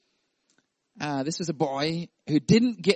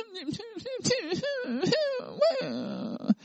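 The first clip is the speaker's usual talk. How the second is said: high squealing laugh. Real, not acted. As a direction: burst into a high giggle.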